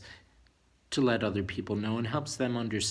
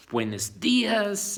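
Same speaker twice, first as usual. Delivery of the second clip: Spanish greeting; 8 semitones higher, 5 dB louder, much slower than usual. Shown as a demonstration. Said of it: "some people say".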